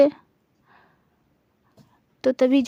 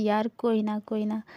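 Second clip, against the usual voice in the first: soothing; 3 semitones lower, 7 dB quieter than usual. Acted. Rubbing friend's back to comfort.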